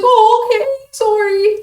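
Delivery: put-on high little-kid apology; imitated sheepish kid. Kid caught grabbing packs, sheepishly sorry. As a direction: mimic a caught child backing off, high-pitched.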